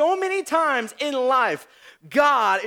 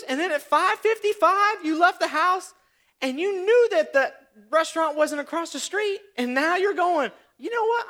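exasperated disbelief; 7 semitones higher than usual. Acted. Exasperated rant continues, high pitch.

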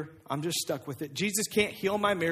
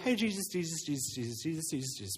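softer, hissed calls of Jesus, Jesus; hushed urgent warning; 5 dB quieter, faster than usual. Acted. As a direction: frantically hush a friend about to do something reckless. Disciples urgently hushing Jesus.

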